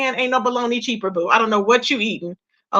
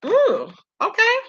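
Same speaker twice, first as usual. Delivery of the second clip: high, slow, drawn-out ooh okay; mock surprise at the number; surprised ooh; 9 semitones higher, slower than usual. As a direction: act taken aback. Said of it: "I was like".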